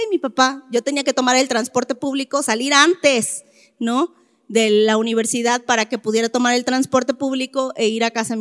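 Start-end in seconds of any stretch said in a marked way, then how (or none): none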